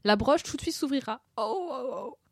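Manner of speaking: hammed-up royal lament; moaning. A narrator's reading. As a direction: moan it pitifully. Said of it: "gémit la pauvre reine".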